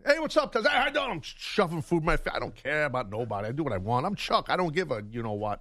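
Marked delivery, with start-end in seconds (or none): 0.1-1.1 high, breezy greeting; casual hallway greeting. Retold or imitated. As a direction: toss off a greeting mid-bite. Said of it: "I eat while I'm walking".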